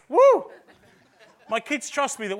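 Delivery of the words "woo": sudden high, loud joking whoop; mock whoop. Preacher joking about leading kids off a cliff, with a loud mock whoop. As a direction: whoop like a thrill-seeking dad. Jokey cheer after the cliff line.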